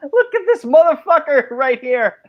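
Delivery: high-pitched words through real laughter; laughing. Real, not acted. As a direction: talk while cracking up. Laughing hard at someone on stream.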